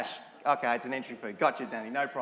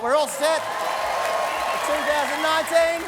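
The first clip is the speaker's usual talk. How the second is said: louder, slow, proud shout; triumphant announcement; 6 semitones higher, 7 dB louder, slower than usual. Real, not acted. Host triumphantly telling the audience the show is funded. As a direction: proclaim it like a victory.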